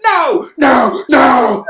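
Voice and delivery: high, loud, wailing mock scream; hammed-up drawn-out shout of refusal; mimicking The Office. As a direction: cry out "no" in over-the-top horror. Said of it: "the good old, uh, Office".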